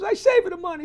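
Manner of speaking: put-on high, loud, half-crying Italian accent; wailing Italian daughter. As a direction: wail it like a tearful girl, Italian accent. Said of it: "She says".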